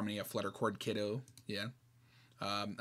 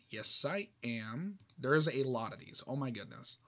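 ordinary delivery, nothing marked

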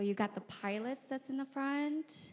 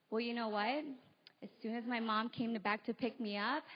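normal talking voice

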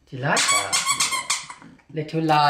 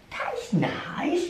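whisper; 9 semitones higher, 7 dB quieter than usual. Real, not acted. Whispered aside.

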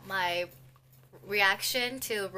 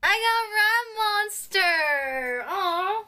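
excited cry; 9 semitones higher, 10 dB louder, slower than usual. Real, not acted. Reveals photocard, disappointed cry.

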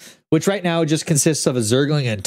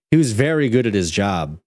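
ordinary delivery, nothing marked

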